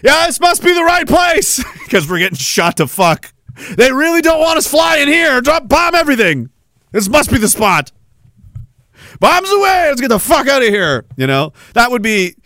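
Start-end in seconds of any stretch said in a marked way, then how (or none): 0.0-11.1 excited pilot. Retold or imitated. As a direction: yell like a bomber crew under fire. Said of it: "It was like".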